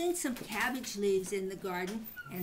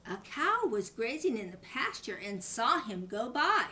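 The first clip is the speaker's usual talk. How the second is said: ordinary delivery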